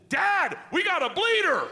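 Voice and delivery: high, loud alarmed call; excited yell. Retold or imitated. Isla calling from the pool to dad, high and loud. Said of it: "Isla pops up out of the pool".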